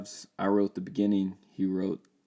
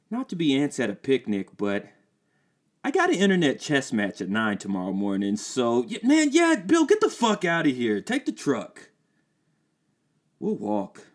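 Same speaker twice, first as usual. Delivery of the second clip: higher, louder put-on voice; impatient friend; 6 semitones higher, 5 dB louder than usual. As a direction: wrap it up and leave.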